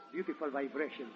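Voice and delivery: ordinary talk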